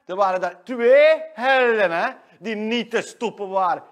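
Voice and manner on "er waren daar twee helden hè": much louder bragging; loud boast. Acted. [name] boasting of his cycling heroics, loud. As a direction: proclaim it like a hero. Boasting about race win.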